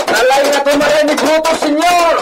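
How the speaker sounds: very high, loud yelling over the gallop; rally the riders, shouting hard; driving the horses on with yells; urgent shouting. Acted.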